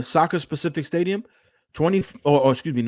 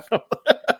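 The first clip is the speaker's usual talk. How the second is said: high burst of laughter; 11 semitones higher than usual. Real, not acted. Laughing after the punchline.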